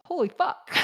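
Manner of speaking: put-on high little kid's parroting voice; toddler voice. Toddler repeats a swear to his mom, high and gleeful. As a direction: pipe it up like a toddler copying a swear. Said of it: "Jasper just goes".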